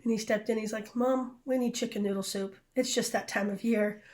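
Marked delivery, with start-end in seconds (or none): none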